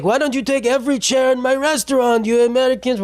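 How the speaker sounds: high mock-sarcastic jab; sarcastic shopkeeper. Venice shop owner scolding American tourists, sarcastic and high. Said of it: "he was being sarcastic".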